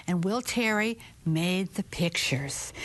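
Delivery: ordinary delivery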